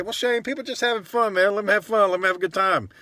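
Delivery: high, mocking objector voice; imagined objector. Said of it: "now you may say".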